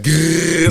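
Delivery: mock growl, drawn out; a long rolling grrr. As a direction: imitate a long, slow growl. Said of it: "girls mention your face, it was like".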